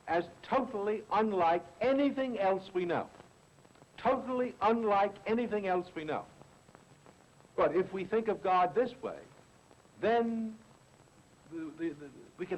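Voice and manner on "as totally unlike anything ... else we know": "totally" pitched higher and pressed; stress on totally. Shown as a demonstration. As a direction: push "totally", let the phrase fall after it. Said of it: "I emphasize the word totally".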